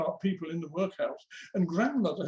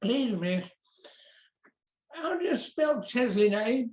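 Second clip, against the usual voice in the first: put-on broad Birmingham-area accent, pitched up; broad local accent; 3 semitones higher than usual. A narrator's reading. Pupil with raised hand asks Miss Merrick, broad local accent. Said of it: "in his broad local accent".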